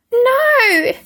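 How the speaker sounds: put-on high, loud child's scream; little brother screaming no. A narrator's reading. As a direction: shriek it, clinging on. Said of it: "screamed Peter".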